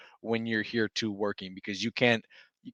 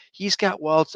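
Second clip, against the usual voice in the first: higher, alarmed mock of the wife; worried wife; 8 semitones higher, slower than usual. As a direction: quote her pitched up and urgent. Wife voiced in a story.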